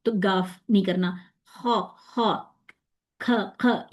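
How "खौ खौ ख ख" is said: खौ and ख with a harsh scraped kh, the sound to avoid; wrong kh demo. Shown as a demonstration. Teacher demonstrates the wrong sound to students. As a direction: rasp out खौ as the mistake. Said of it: "ये नहीं करना".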